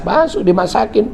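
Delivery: ordinary delivery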